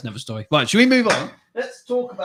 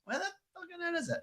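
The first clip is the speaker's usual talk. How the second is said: frustrated; 13 dB quieter, faster than usual. Real, not acted. Frustrated at cursor.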